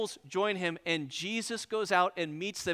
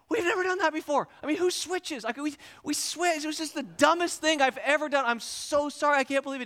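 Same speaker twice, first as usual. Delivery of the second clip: high-pitched pleading; frantic apology; 8 semitones higher than usual. Retold or imitated. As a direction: grovel to the officer in a high voice. Acted grovelling apology to officer.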